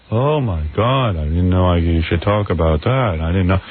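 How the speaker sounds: low, slow, droning guest impression; mock a reluctant guest, low and dragged out; George Clooney. Imitated guest dismayed at being asked, low slow drone. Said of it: "this is how he talks".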